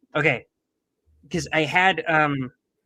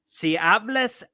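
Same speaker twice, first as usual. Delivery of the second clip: slower and higher, each word spaced; slow careful Spanish; 7 semitones higher, 4 dB louder, much slower than usual. Shown as a demonstration. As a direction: say it again slowly for him. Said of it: "Say, say it again".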